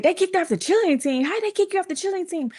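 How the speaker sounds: high, fast imitation of a shocked mom; acting out mom's incredulous outburst; outraged disbelief. Mom's shocked reaction, acted out.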